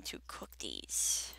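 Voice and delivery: soft slow whisper. Real, not acted. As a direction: hush it, barely voiced. Whispered aside while playing.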